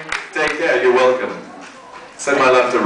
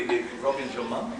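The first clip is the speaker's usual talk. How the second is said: ordinary talk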